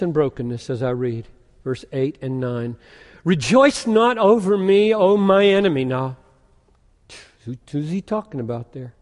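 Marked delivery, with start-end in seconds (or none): 3.2-5.8 higher and louder, proclaiming; preaching scripture. Acted. Preacher reading Micah to the congregation, loud proclamation. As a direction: declare the verse like a challenge.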